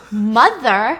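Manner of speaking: loud incredulous rising Mother; outraged. Acted. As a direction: throw the word back in disgust. Sarah outraged at the word.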